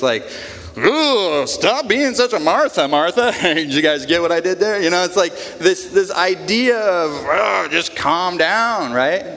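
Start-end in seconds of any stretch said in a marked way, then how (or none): 0.8-3.3 teasing, sing-song put-down; mock dismissive teasing. 7.1-8.9 slow, drawn-out dismissive calm down; harsh brush-off. Retold or imitated. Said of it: "Like kind of harsh".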